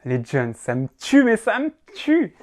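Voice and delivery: slow, loud mock exclamation climbing high; playing the beauf. Amused by the young like a beauf, mock-exclaiming. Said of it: "j'aime bien faire le beauf".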